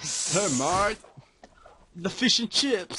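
playful British catchphrase; hammy fake British; mock British accent. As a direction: put on a silly British voice. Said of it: "British impersonation".

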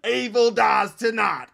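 slow, loud, high mock-heroic proclamation; mock dramatic line. Mocking the movie's catchphrase, slow and shouted. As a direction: boom it out like a cheesy hero. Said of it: "don't y'all be saying".

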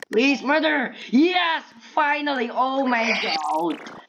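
high, excited cheer, drawn out at the end; jubilant. Real, not acted. Player finally getting to be murderer, jubilant. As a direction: cry out in overjoyed relief.

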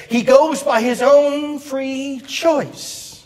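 high, drawn-out evangelist delivery; slow, ringing pulpit proclamation; Billy Graham. Retold or imitated. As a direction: quote a revival preacher, pitch high, words stretched. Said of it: "he says".

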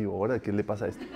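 low, soft, quick mock muttering; onlooker's puzzled aside. Imitating onlookers staring at someone decreeing, puzzled aside. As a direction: toss it off under the breath with a laugh. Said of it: "se te quede viendo así".

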